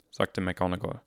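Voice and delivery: usual speaking voice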